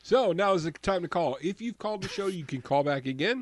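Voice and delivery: bright upbeat radio call-in; radio announcer. Acted. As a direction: sell the call-in like a DJ.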